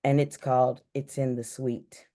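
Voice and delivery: ordinary talk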